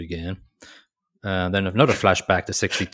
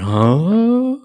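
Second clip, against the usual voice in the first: mock confused huh; 12 semitones higher, 9 dB louder than usual. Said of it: "And Chris is going".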